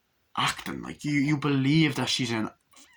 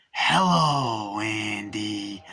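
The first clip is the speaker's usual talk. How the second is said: drawn-out menacing doll hello; Chucky's sinister greeting; 4 dB louder, much slower than usual. Retold or imitated. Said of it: "and he's just like".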